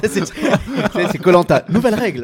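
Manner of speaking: cracking up so hard the words stutter; laughing. Real, not acted. Co-host mocking the quiz rule, laughing loudly.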